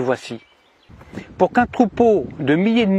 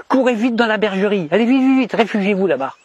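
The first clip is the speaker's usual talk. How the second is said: feigned urgency, herding frightened sheep; play-acted alarm, higher voice; mock panicked cry; 5 semitones higher than usual. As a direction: urge the flock to run, voice pitched high. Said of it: "je leur crie".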